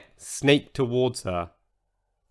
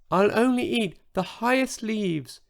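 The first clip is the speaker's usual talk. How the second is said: tiny whisper; 8 semitones higher than usual. Acted. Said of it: "he whispered".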